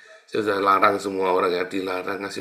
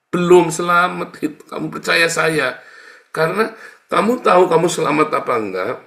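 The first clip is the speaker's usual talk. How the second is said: loud, high, emphatic preaching; preaching at full force; 7 semitones higher, 8 dB louder than usual. Acted. Preacher scolding the congregation, loud and forceful. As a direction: hammer the point home from the pulpit.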